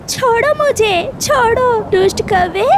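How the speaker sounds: high, frantic pleading cry; panicked cry. Acted. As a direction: struggle and shout to be let go. Mother bird seized, cries out.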